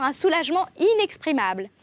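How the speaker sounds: usual speaking voice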